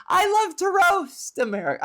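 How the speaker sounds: high, loud, exaggerated glee; gleeful crowing. Comedian confessing she loves roasting America, gleeful high crow. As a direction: belt it out with mock delight.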